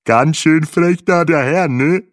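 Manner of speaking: slow, loud, chuckling African guide; amused guide. Acted. Guide teases Breitling back in his accent, slowly. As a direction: tease him back good-naturedly, slowly. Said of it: "schmunzelte Bahe".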